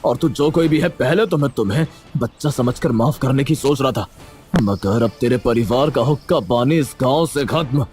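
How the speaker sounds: mildly angry headman; angry, forceful. Acted. Headman threatens villagers playing Holi, angry and forceful. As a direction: order everyone to stop with some force. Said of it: "गुस्सा होकर जोर से बोले".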